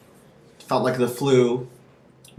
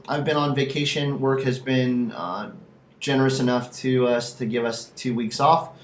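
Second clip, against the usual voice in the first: ordinary talk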